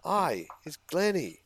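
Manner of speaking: high, slow, sappy imitation of a friend; sugary lovesick friend voice, high and slow; mocking Glennie's video voice. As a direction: drag it out sweetly, mocking him. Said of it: "that video you sent us where it was like".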